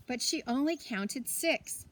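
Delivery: ordinary delivery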